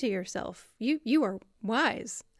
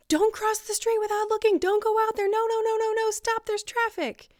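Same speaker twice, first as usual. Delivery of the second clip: high, loud panicked warning; madwoman screaming; 11 semitones higher, 4 dB louder than usual. Acted. Madwoman trying to protect you from traffic, screaming. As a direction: shriek frantic warnings, much higher and louder. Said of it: "So the madwoman screams".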